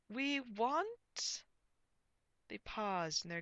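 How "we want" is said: high, loud, suspended announcement; projected comic knight voice; pompous knight. A narrator's reading. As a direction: announce grandly and leave it hanging. Knight begins grand demand.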